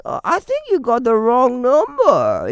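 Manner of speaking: high, put-on silly voice; acting stupid. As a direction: play a clueless stranger, hamming it up. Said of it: "I act all stupid".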